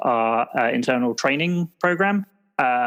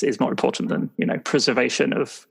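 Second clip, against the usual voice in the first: ordinary talk, nothing marked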